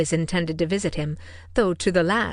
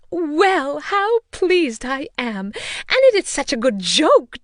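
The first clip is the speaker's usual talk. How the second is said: higher, louder, slowed delight; delighted mother; 8 semitones higher, 6 dB louder, slower than usual. A narrator's reading. Mother rejoicing to the family, high delight. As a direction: savour the happy news aloud.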